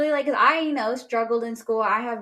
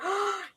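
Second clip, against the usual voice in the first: a high sudden intake of breath; sharp surprised gasp; 10 semitones higher, 5 dB quieter than usual. Retold or imitated. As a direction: gasp in shock like the stunned crowd. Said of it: "people are like".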